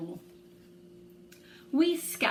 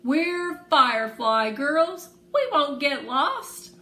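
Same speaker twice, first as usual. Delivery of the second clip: slow, loud and firm; proud defiance; 7 semitones higher, 9 dB louder, slower than usual. Acted. Girl proudly insists to Ree they won't get lost, slow and loud. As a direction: chin up, insist on it. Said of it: "Fire thrusts her chin up".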